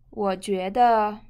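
normal talking voice

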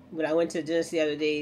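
ordinary delivery

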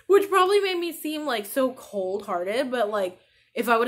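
crying as she explains, real; tearful mother on camera, really crying. Real, not acted. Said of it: "I'm getting teary-eyed now".

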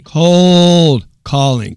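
slow, drawn-out amazed repeat. Real, not acted. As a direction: stretch it out in disbelief. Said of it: "Cold calling, dude".

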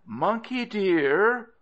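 high, slow, sing-out endearment; wheedling call. Acted. Crocodile luring Monkey from the tree, wheedling. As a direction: coax sweetly, stretched out and pitched high. Crocodile calls again, coaxing.

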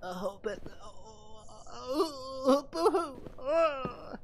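high, whiny fake sobbing; mock crying. Streamer mocking a scared game character, hammed-up crying. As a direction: blubber in an exaggerated, babyish boo-hoo.

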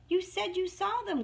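high-pitched mild complaint; understated, accusing Fox; grumbling. A narrator's reading. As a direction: grumble lightly at the mouse. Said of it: "grumbled Fox".

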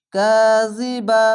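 কাজিবা chanted slowly, ka stretched one alif; slow tajweed কাজিবা; Quranic recitation. Shown as a demonstration. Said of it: "এক আলিফ টেনে পড়বেন".

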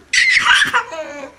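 delighted laugh. Real, not acted.

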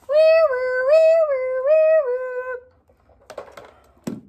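high loud wee woo siren; playful siren imitation; siren noise. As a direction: wail like a fire engine. Imitating fire engine siren.

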